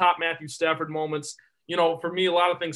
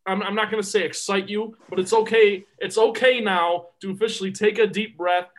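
ordinary talk, nothing marked

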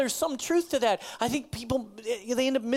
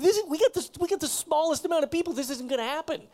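higher, whining complaint of a weak little man; whiny weak man; 6 semitones higher, faster than usual. Retold or imitated. Preacher voices Gideon whining to God, weak and higher. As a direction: whine that it's impossible. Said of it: "starts kind of a whiny".

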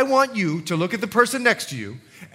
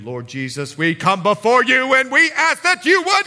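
mock prayer turning screaming; 4 semitones higher, 4 dB louder than usual. Prayer meeting shouting for God's attention, mock rising prayer. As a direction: build a parody prayer into yelling. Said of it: "they start screaming at God".

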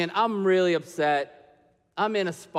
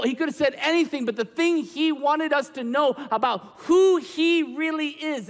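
raised, emphatic sermon delivery with stretched key words; forceful preaching; 5 semitones higher than usual. Real, not acted. Preacher telling congregation who Jesus is, forcefully. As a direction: drive each point home to the congregation, higher.

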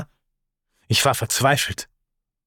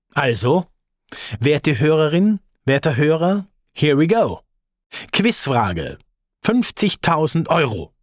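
quiz host; 4 semitones higher than usual. Pretend. Narrator playing quizmaster for the listener, raised and showy. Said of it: "Spielen wir mal eine nach".

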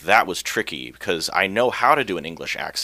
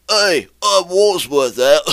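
much higher, louder, slow cartoon Brit; mock English accent; 11 semitones higher, 9 dB louder, much slower than usual. Shown as a demonstration. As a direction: overplay a silly English voice, drawn out. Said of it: "I'm also not gonna do the".

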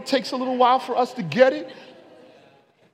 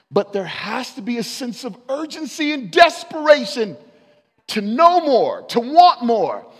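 loud, driving pulpit preaching; full-force preaching; 2 semitones higher, 10 dB louder than usual. Acted. Preacher urging the young congregation toward an extraordinary life, at full force.

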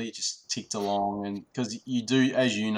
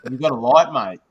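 laughing Australian line; 3 semitones higher, 11 dB louder than usual. Shown as a demonstration. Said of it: "That's what he should say".

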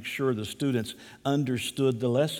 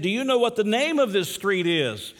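high, raised-voice question; lost man asking; 7 semitones higher, 3 dB louder than usual. Retold or imitated. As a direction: play a lost traveller quizzing a boy. Lost man asks again.

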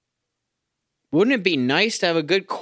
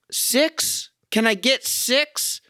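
sarcastic plea; 8 semitones higher, much slower than usual. Real, not acted. Mocks team's low score.

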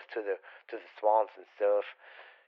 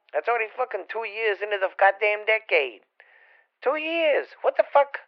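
surprised; 10 semitones higher than usual. Real, not acted. Shocked at time passing.

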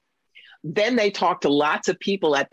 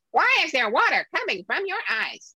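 high, wide-eyed alien asking, much higher pitch; Marvin the Martian; 11 semitones higher than usual. Retold or imitated. A curious Martian asks the crying woman about her tears, high voice. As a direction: play a baffled little Martian, curious, voice way up. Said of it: "toddles up to Kathy and goes".

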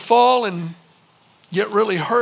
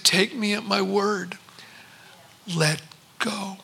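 calm reassurance; 6 dB quieter than usual. Retold or imitated. Father calmly reassuring son.

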